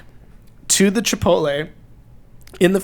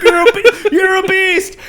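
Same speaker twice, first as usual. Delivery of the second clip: laughing praise; 11 semitones higher, 4 dB louder than usual. Retold or imitated.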